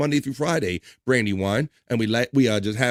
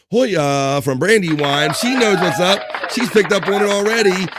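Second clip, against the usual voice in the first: hyped praise; 7 semitones higher, 5 dB louder than usual. Real, not acted. Host cheering a new chatter's Hoya with hyped praise. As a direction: cheer the newcomer on.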